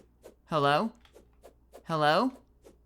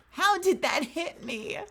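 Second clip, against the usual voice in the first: outraged disbelief; 10 semitones higher than usual. Real, not acted. Gamer outraged at hit.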